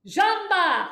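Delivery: "Jamba!" barked high and loud from the belly; stage yell. Shown as a demonstration. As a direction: punch "Jamba!" out with the abs. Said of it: "This is what we do for stage yelling".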